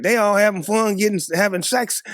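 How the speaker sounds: high, loud put-upon griping; hammed-up teenage grievance; mock indignant complaint. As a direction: protest the unfairness, high and loud. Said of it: "You like".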